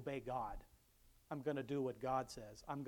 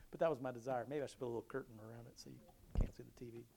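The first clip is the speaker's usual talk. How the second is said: ordinary talk, nothing marked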